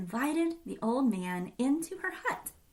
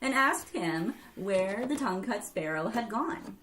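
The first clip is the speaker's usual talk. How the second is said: usual speaking voice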